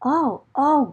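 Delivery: ordinary delivery, nothing marked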